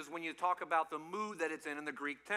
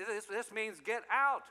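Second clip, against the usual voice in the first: normal talking voice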